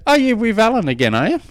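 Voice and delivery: high, fast mock-suspicious wife voice; teasing wife. Wife teasing her husband about his old coach's program, high and fast. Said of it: "And my wife goes".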